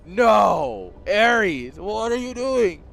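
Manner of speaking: dismayed yell. Real, not acted. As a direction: cry out in disbelief at the player's blunder. Caster sees player's blunder.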